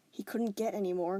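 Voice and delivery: ordinary delivery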